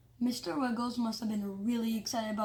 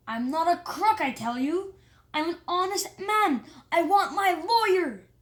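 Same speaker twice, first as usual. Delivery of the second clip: loud, higher pleading protest; desperate protest; 7 semitones higher, 6 dB louder than usual. Acted. Mayor dragged off by the guard, loud rising protest. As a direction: protest innocence louder and louder.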